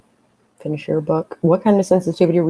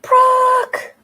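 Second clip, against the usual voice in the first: squealing the name high and loud; excited anime fan; 5 dB louder than usual. Pretend. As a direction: gush like a starstruck fan. Reading a chat message aloud.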